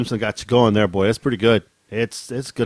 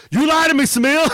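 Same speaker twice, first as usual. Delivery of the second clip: mock betrayed outcry. Betrayed fighter crying out at his god, hammed-up high. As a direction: ham up the betrayed warrior's cry.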